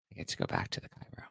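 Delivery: breathy secret whisper, fast; whispered aside. Real, not acted. Hushed excited aside about chiro.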